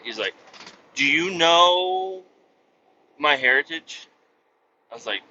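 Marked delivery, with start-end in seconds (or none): 0.9-4.1 slow, loud, solemn owner; solemn slow question. Retold or imitated. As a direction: ask it slowly and gravely.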